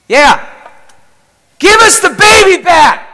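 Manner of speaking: full-force high scream, imitating the son. Son screams at Jesus for the baby, screaming. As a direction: scream it at the top of your lungs. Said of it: "screams out at the top of his lungs".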